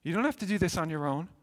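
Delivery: Paul gently urging the church, softly; gentle encouragement. Retold or imitated. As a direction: coax them gently to shine. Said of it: "And he says".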